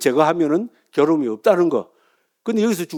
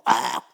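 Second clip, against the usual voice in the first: long wordless Zen yell; acted monk's sudden roar; Zen shout; 4 semitones lower, 3 dB quieter, much slower than usual. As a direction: let out one drawn-out cry. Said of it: "하면 뭡니까?".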